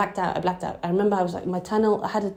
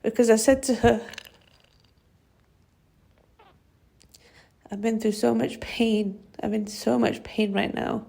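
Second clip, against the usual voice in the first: tearful; 3 semitones higher than usual. Real, not acted. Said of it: "If you excuse me".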